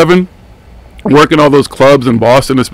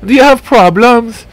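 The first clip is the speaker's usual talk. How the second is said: Miss Cleo psychic; 10 semitones higher, 3 dB louder than usual. Retold or imitated. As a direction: sell a psychic reading like a TV hotline ad. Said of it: "he starts talking about Miss Cleo".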